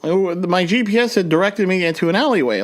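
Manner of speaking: usual speaking voice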